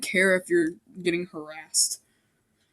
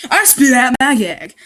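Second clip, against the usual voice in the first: louder, higher retake of I spit out my gag, "I" stressed; mock dramatic reread; 4 semitones higher, 8 dB louder than usual. Shown as a demonstration. Reader redoing the opening line with more drama. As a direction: hit it harder the second time. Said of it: "That means he just threw up".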